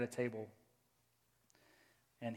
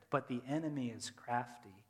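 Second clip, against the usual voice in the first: usual speaking voice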